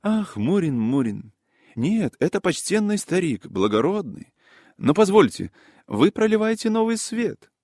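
high, lively character voice, struck by a thought; excitable high-voiced gossip; animated gentleman. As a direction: protest warmly, then lift high with sudden realisation. Voices Yaroslav Ilyich replying.

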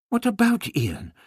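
high, sharp alarmed question. A narrator's reading. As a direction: ask in sudden alarm. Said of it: "Barbara asked in alarm".

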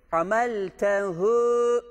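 "Hameltehu" slowly, with the final hu stretched; drawn-out he. Shown as a demonstration. Teacher draws out the he in "Hameltehu" for the class. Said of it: "şu he'yi çekiyoruz".

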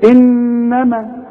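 إنما with the doubled "n" held long; nasalised ghunna. Shown as a demonstration. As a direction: hum through the nose on the noon of إنما. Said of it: "شفت إن أنا غنيتها إزاي؟".

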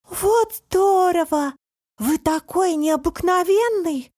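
high, slow gush of wonder; admiring. A narrator's reading. Тусик admires the unusual house, high and wondering. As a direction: marvel at it in a high, drawn-out voice.

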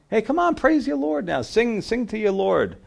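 high-pitched mock cheering-on. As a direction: taunt them in a high, jeering voice. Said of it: "they were mocking them".

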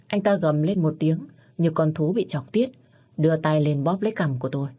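ordinary delivery, nothing marked

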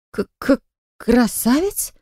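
high, slow, stuttered question; stammering in dismay. Acted. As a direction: stammer it out, voice failing. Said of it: "упавшим голосом уточнил я".

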